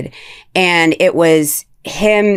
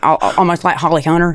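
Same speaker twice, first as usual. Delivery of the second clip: usual speaking voice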